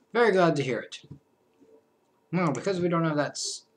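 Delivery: ordinary talk, nothing marked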